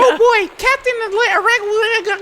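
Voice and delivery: put-on high, slow girly voice; little girl voice. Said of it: "I like your little girl voice".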